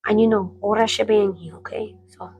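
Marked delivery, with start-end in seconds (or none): 1.6-2.3 whispered aside. Real, not acted. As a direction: breathe it out unvoiced.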